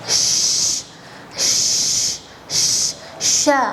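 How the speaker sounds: sh sh sh sha with wide spreading air; shin demo. Shown as a demonstration. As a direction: sha with a long breathy sh. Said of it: "tetap kita merasa angin huruf shin".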